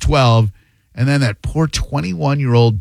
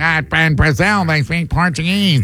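mock complaining caller; 2 semitones higher than usual. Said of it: "that guy was just like".

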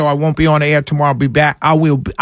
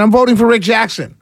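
normal talking voice

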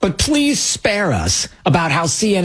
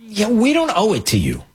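higher-pitched dismissive retort; mock rebuff; 6 semitones higher than usual. Officials answering the entitled press, mock rebuff. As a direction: brush them off as the officials would.

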